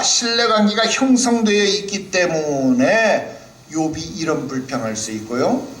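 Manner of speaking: normal talking voice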